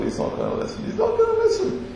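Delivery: very soft muttered aside; private thought. Acted. Moses thinking to himself before Pharaoh, muttered. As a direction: mutter it hopelessly under the breath. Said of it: "he just thought".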